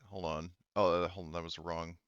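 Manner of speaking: ordinary talk, nothing marked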